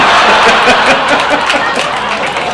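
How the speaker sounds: airy, helpless laughter; real laugh. Real, not acted. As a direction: laugh at the joke. Laugh while retelling the prank.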